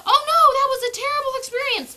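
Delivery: mock the scared puppy's overreaction; puppy voiced in a fast high panic; frightened puppy. Shown as a demonstration. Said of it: "and then they will go".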